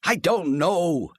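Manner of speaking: slow, loud, drawn-out cry; cried out. A narrator's reading. Husband crying out to his wife, loud and stretched. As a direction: cry it out in frustration. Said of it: "he cried".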